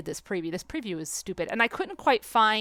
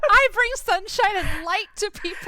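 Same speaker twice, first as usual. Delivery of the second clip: high, loud, laughing insistence; mock protest; 11 semitones higher, 6 dB louder than usual. Real, not acted. Laughing host mock-insisting she is kind, high and loud. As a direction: protest your sweetness in play.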